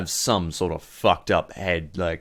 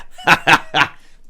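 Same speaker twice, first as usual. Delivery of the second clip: loud, higher mocking cackle; villain laugh; 8 semitones higher, 8 dB louder than usual. Boss teases the pirate, loud cackling laugh. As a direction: laugh at your own jibe.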